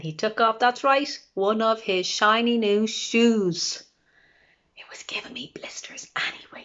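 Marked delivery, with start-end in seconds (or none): 4.8-6.6 breathy whispered giant's aside. A narrator's reading. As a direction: hush it as a secret. George's aside, hushed.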